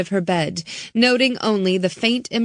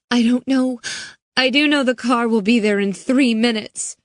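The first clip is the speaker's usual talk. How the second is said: put-on higher, frightened girl's voice; scared girl; 6 semitones higher, 4 dB louder than usual. Girl warning Riley, higher and scared. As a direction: tell it with rising dread. Hannah's voice.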